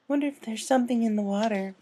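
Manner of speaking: joking. A narrator's reading. Fluttershy jokes with friends on the shore, gentle tease. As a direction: tease lightly as a shy pony. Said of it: "Fluttershy joked".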